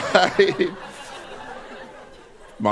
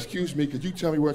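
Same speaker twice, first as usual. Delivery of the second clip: polite soft ask; 7 dB quieter, faster than usual. Retold or imitated. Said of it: "I was like".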